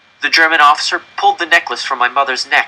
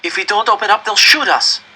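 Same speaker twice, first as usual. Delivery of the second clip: frightened plea; 10 semitones higher than usual. Acted. Boy pleading with frozen mother.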